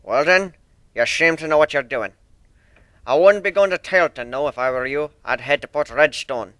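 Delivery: put-on loud, stern old sailor with rolled R's; stern sea dog accent. One-winged Pegasus apologising to Fluttershy, stern thick accent. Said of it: "the Pegasus advised".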